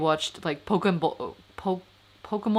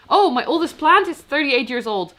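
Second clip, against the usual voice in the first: surprised; 7 semitones higher, 6 dB louder than usual. Real, not acted. Reading a surprising comment.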